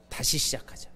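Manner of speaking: hushed, gentle restart; whisper. Shown as a demonstration. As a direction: whisper as Jesus' own voice to you. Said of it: "자, 시작". (예수님 음성처럼 속삭이며)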